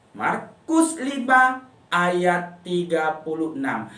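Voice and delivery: "Markus lima ayat tiga puluh enam" spoken slowly, each number drawn out; teacher slowly dictating the memory verse to children; slow recitation. Shown as a demonstration. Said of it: "Ayat hafalannya dihafal".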